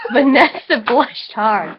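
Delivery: slow words through giggles; giggling through the line; laughing. Real, not acted. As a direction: read it while cracking up. Laughing while reading.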